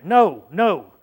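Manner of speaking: sharp, loud repeated no; loud denial. Retold or imitated. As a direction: deny it hard and fast. Peter's denial acted out.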